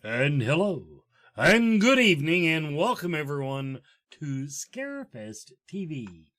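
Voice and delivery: big, loud radio host welcome. Real, not acted. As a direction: open the show in a booming announcer voice. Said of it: "using my radio voice".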